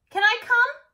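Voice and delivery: very high, loud little voice; small person voice. A narrator's reading. Little sibling asking to tag along, high and hopeful. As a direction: beg hopefully like a toddler. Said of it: "said the small person".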